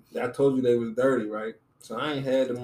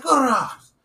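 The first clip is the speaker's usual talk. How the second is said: mock teacher order; 13 semitones higher, 8 dB louder, faster than usual.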